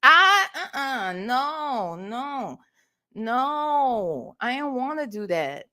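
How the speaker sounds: normal talking voice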